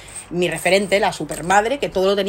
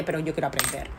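quiet inner resolve; 4 semitones lower, 9 dB quieter, faster than usual. Retold or imitated. Voicing her own inner resolve.

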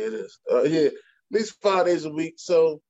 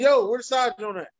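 demanding fan; 6 semitones higher, 3 dB louder than usual. Retold or imitated. Fans' messages voiced.

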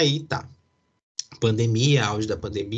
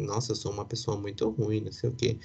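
much softer, inward self-reproach; self-blaming thought; 7 dB quieter than usual. Retold or imitated. Son blaming himself after the hug, soft inner voice. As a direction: murmur it to yourself in blame. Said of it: "eu fiquei me culpando".

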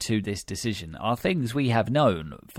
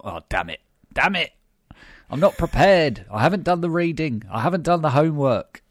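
mock panic; 7 semitones higher, 6 dB louder than usual. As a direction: act out someone caught unprepared, panicking. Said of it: "had a bit of a panic".

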